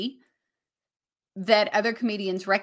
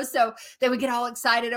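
ordinary talk, nothing marked

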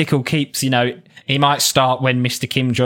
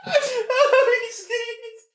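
laughing; 4 dB quieter, slower than usual. Real, not acted. Collapsed in laughter.

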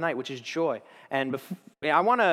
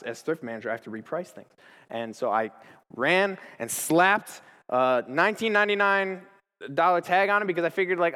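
ordinary talk

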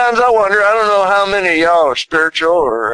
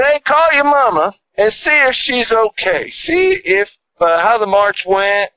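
high, coaxing inner voice nudging him; a gentle little voice in his head, pitched up; 7 semitones higher than usual. Retold or imitated. Inner voice urging him to call his mama, high and coaxing. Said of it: "that little voice said".

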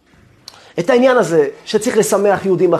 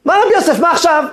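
high-pitched irritated greeting; exasperated; 11 semitones higher, 4 dB louder, faster than usual. Retold or imitated. Rabbi answering the repeat caller, exasperated.

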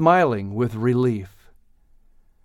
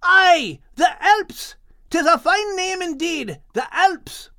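Scottish shout. Acted. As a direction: bellow it, half scolding half proud. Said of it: "Max continued to shout".

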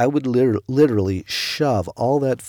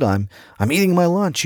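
high, hurried, through a mouthful of food; 8 semitones higher, 5 dB louder than usual. Retold or imitated. Young worker to brother, mouth full and higher, hurried. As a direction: gobble the words like a rushed eater. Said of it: "With a mouthful of food".

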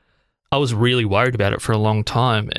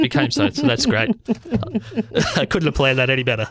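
high, fast words through a laugh; giggly, talking through laughter; 7 semitones higher than usual. Real, not acted. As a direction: laugh it off, a bit embarrassed. Laughs at his own self-praise.

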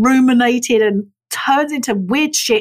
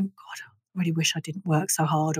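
much softer, lower, rueful sigh of regret; hushed, low voice of regret; regretful; 6 semitones lower, 11 dB quieter than usual. Pretend. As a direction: murmur it like a last regret. Imagined regretful deathbed self.

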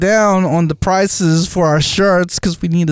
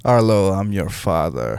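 Darth Vader voice; 7 semitones lower, 5 dB quieter than usual. Pretend. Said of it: "want the kids to call him Darth Vader".

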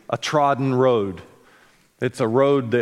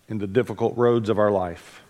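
prayer; 3 semitones lower than usual. Real, not acted. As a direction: pray gently over the congregation. Opening prayer before the sermon.

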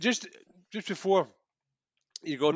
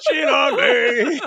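much higher, loud, very slow laughing yell; mocking yell; 12 semitones higher, 12 dB louder, much slower than usual. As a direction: echo the villain's yell, laughing and drawn out. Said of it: "I know".

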